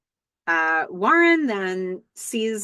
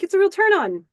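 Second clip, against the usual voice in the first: much higher and louder, overeager; high, loud imitation of Warren; eager Warren; 11 semitones higher, 5 dB louder than usual. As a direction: push it out like a nervous flirt. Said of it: "he's like".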